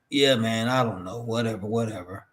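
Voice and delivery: mock a guest who won't engage, voice dropping away; indifferent brush-off. Wrestler brushing off the podcast host's questions, low indifferent mumble-along. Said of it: "like, two-word answers".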